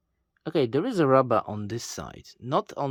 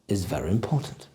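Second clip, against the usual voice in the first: ordinary talk, nothing marked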